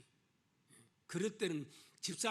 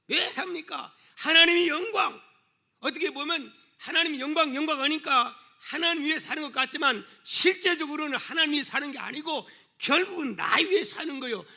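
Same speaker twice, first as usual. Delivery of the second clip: high, insistent sermon delivery; full-force preaching; 8 semitones higher, 9 dB louder than usual. Real, not acted. Preacher asking the congregation why they live, full-force preaching. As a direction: drive the point home with raised voice.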